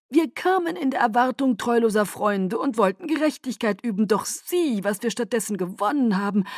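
courtly Aro. Acted. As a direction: flatter her with lofty charm. Aro's speech.

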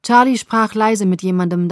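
usual speaking voice

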